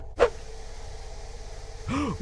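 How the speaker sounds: sharp breathless gasp; startled gasp. Real, not acted. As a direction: gasp in fright. Scared by monster.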